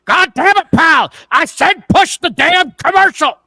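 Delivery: furious high-pitched mock shout; Vince McMahon screaming. Boss screaming at his announcer over a commercial, furious shout. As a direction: bellow it like an enraged boss in a headset. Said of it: "Vince McMahon screaming at him".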